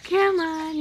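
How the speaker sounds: squeaky praising a dog. Real, not acted. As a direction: coax the puppy loudly. Coaxing the puppy.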